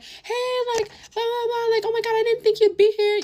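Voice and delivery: mock a gushing classmate in a high squeal; mocking bubbly girl. Art-class girl greets her at the party, high mocking squeal. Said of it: "you know how bitches be".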